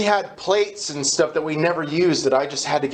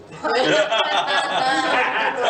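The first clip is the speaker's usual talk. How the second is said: a burst of real laughter; 9 semitones higher than usual. Real, not acted. Laughing at the counselor's breezy greeting. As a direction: laugh out at the memory of him.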